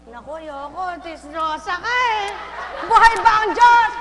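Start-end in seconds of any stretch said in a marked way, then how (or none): 2.9-3.9 loud, high rallying shout; shouted call. Real, not acted. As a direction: rouse the crowd with the call. Preacher shouts to congregation. (malakas na sigaw sa kongregasyon)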